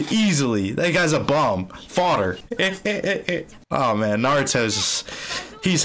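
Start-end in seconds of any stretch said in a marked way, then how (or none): 2.4-3.4 short chuckle in pulses; raised, stuttering little laugh. Real, not acted. Viewer gloating over the fodder villain, chuckle.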